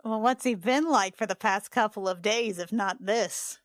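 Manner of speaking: ordinary delivery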